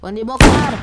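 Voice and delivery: loud commentator yell of a name; excited shout. Real, not acted. Player scoring chance. (teriakan bersemangat)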